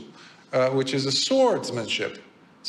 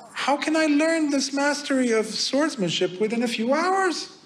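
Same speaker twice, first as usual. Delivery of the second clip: terrified disbelief; 11 semitones higher than usual. Retold or imitated. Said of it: "So he's terrified".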